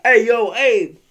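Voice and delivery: shouting for a friend to come out; calling out. Retold or imitated. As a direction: hail your buddy from the porch.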